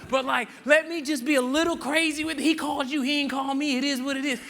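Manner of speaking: high, loud, goofy disciple egging Peter on; playful disciple voice. Retold or imitated. Disciple urging Peter out of the boat, hammed-up high excitement. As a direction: play a hyped buddy cheering from the boat. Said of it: "one guy that was like".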